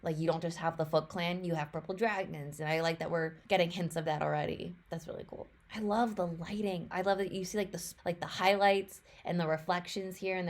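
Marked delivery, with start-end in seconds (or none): 4.9-5.5 breathless whisper of delight; whispered awe. Real, not acted. Fan whispering to herself over the trailer, hushed.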